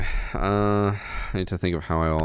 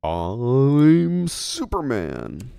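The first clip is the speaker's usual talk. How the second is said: high, loud, very slow hero voice; mock superhero voice; 6 semitones higher, 4 dB louder, much slower than usual. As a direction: ham up a superhero line, drawn out. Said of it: "don't I sound like Batman".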